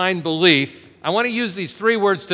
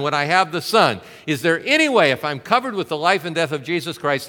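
normal talking voice

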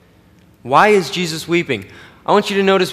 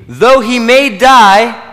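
slow, loud and higher on every word; drawn-out emphasis; 6 semitones higher, 8 dB louder, much slower than usual. Real, not acted. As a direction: stretch each word out for weight. Preacher stressing scripture phrase.

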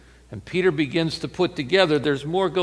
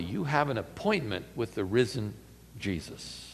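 fading into a whisper; hushed; 4 semitones lower, 11 dB quieter than usual. Acted. Angel tells the women they will meet Jesus, fading to a whisper. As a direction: let it drop away to a whisper on Jesus.

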